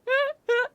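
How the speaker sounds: high-pitched giggle burst; high squealing laugh. Real, not acted. Streamer laughing at her own butt joke, high squeal.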